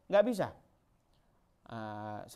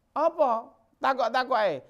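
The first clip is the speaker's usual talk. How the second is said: agitated companion; 10 semitones higher, 7 dB louder than usual. Acted. Companion chides the woman.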